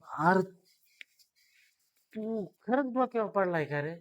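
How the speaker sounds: man stunned by the loan pitch; incredulous. Acted. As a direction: ask if he's lost his mind. Incredulous reply to absurd offer.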